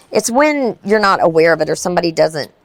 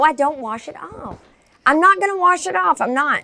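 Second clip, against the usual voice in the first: higher, stubborn refusal; defiant; 9 semitones higher than usual. Real, not acted. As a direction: dig in and refuse. Defiant refusal, rising pitch.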